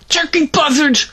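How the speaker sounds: high-pitched mild yell; yelled curse. A narrator's reading. Boy stumbles and swears oddly, high yell. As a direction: cry it out as a mock swear. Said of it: "I stumbled a little and yelled".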